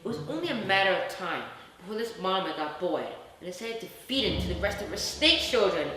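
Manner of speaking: plain reading voice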